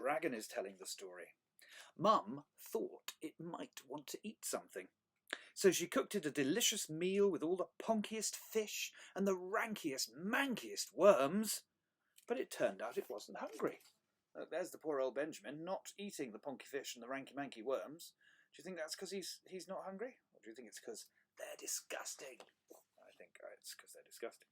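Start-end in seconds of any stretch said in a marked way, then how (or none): 2.0-13.8 lively, higher storytelling voice; animated picture-book reading; story read to children. A narrator's reading. 20.3-24.5 hushed, secretive whisper aside; very soft, low confiding whisper; conspiratorial whisper. Pretend.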